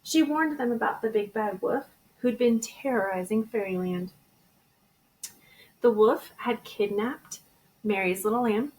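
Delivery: plain reading voice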